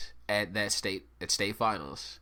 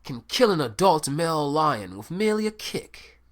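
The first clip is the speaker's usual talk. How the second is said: much higher, louder Australian showman voice; Australian accent; 7 semitones higher, 5 dB louder than usual. Retold or imitated. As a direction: wow them with a mock Australian nature-show fact. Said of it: "So I did it in an Australian accent".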